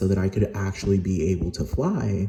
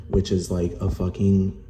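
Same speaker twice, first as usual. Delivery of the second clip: ordinary delivery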